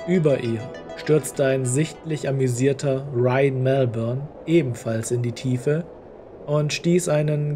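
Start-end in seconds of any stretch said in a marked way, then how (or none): none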